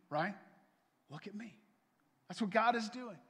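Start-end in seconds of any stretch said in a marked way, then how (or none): 1.1-1.6 much softer, hushed calming; soothing parent. Retold or imitated. Parent kneeling by frightened child, soft and soothing. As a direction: hush a panicking child gently.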